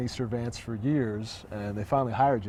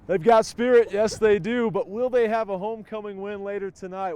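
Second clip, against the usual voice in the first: raised, louder presenter call; loud announcer enthusiasm; 6 semitones higher, 10 dB louder than usual. Real, not acted. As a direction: project it high after the cheer. Host calls out after the cheer.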